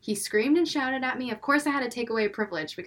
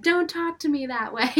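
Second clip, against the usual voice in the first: mock scolding; 5 semitones higher, 4 dB louder than usual. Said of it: "and I'm yelling".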